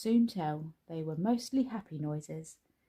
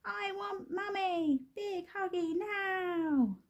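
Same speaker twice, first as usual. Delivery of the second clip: put-on much higher, slow whiny baby voice; wailing toddler; 13 semitones higher, slower than usual. A narrator's reading. Baby clinging to the bedclothes, slow high wail. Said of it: "screamed the baby".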